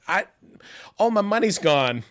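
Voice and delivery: chirpy retold confession; mock cheerful announcement. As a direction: announce disaster like good news. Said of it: "And I'm like".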